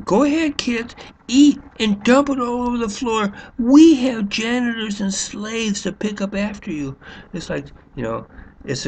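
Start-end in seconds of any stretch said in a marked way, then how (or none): 0.0-7.0 mocking the teacher. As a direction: sneer at her by hamming up her careless permission. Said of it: "she tells them".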